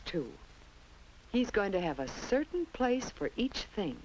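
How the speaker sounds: usual speaking voice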